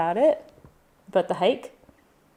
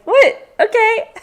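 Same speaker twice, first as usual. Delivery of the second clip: high, loud startled squeak; surprised reaction; 13 semitones higher, 6 dB louder, slower than usual. Retold or imitated. All of us answering the surprise tour guide, high-pitched surprise. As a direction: act out baffled compliance, voice jumping way up. Retelling their startled reply to host.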